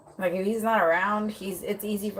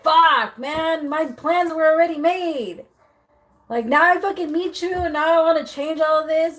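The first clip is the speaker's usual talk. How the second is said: higher and louder, hammed-up exasperated rant; mock frustrated guy; 9 semitones higher, 6 dB louder than usual. Reader voicing the man cursing his ruined plans, mock exasperated. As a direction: vent like a guy whose plans just got wrecked. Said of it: "He's just like".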